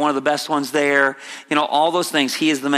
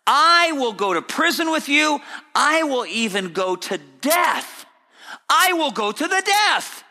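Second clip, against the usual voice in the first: rising, insistent vow of loyalty; defiant Peter; 6 semitones higher than usual. Retold or imitated. Preacher voicing Peter vowing to the Lord, high and defiant. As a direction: swear it, climbing higher. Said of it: "This idea of".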